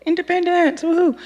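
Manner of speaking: high, thrilled squeal of freedom; giddy excitement. Retold or imitated. New driver cheering her first solo drive, excited high squeal. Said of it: "I'm like".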